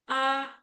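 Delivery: A high and level; erster Ton. Shown as a demonstration. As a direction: hold A on a high, even note. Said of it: "untuk nada pertama".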